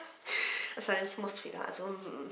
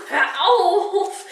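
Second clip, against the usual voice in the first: mock outrage; 7 semitones higher, 8 dB louder than usual. Mock outrage at kids today.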